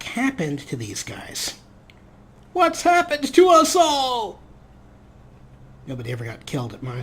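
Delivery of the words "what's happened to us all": high, loud, slow mock cry; hammed-up dramatic wail. Host voicing the characters crying in despair. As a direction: overplay the despair.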